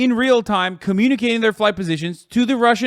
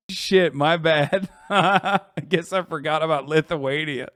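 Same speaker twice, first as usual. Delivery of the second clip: laughing admission; 4 semitones lower than usual. Real, not acted. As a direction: laugh while owning the mistake. Admits mistake, laughing.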